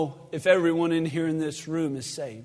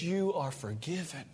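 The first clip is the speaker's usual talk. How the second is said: soft, hushed and tender; tender forgiveness; 2 semitones lower, 11 dB quieter than usual. Acted. Jesus to the forgiven woman, soft and tender. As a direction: hand her forgiveness softly.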